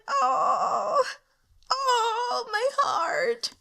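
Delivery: high, wailing "oh my heart"; overwhelmed fan cry. Retold or imitated. Reliving the sword fight for viewers, high anguished cry. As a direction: cry out like your heart can't take it. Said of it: "I was like".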